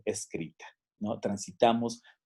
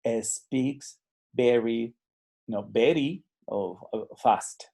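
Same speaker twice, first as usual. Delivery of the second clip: English with a Mexican Spanish accent, slow and halting; much slower than usual. Shown as a demonstration. Teacher demonstrates a beginner's Spanish-accented English. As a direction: learner speaking English with a heavy Spanish accent.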